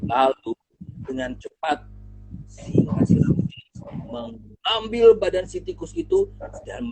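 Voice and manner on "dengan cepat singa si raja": ordinary delivery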